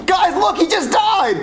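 high-pitched shocked announcement; alarmed. Acted. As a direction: blurt it out in horror. Boy announces teacher's death.

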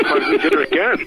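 laughing through the line; chuckling as he says it. Real, not acted. Cracking himself up.

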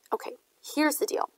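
bossy big brother. A narrator's reading. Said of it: "in my bossiest Big Brother voice".

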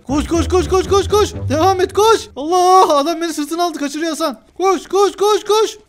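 high, loud frantic cries; frantic urging. Real, not acted. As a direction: urge him on in a panic. Fleeing in game, urging run.

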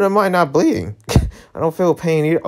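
normal talking voice

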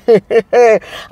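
short amused laugh; a quick burst of laughter. Real, not acted. Vlogger laughing at her own joke about [name], short laugh.

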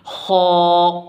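خا rasped from the upper throat, heavy; throaty kha. Shown as a demonstration. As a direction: scrape خا from the top of the throat. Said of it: "یہ بھی حلق سے نکلے گا".

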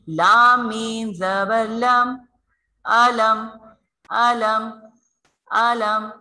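slow letter drill for अलम; tajweed spelling. Shown as a demonstration. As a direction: spell अलम slowly and join the sakin मीम. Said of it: "साकिन हर्फ को अपने से पहले वाले हर्फ से मिलाकर".

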